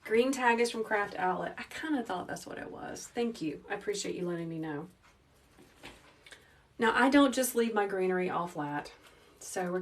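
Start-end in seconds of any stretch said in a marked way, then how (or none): none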